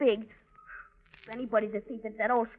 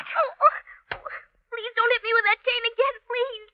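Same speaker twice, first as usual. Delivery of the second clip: higher, crying out as the cane hits; pleading in pain; 8 semitones higher than usual. Acted. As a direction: beg him to stop, hurting. Boy beaten with cane.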